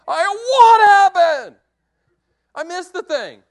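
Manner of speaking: high, loud, slow "what happened?"; loud, high, slow and incredulous; stunned disbelief. Retold or imitated. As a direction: cry out in shock. Shocked he missed the deer.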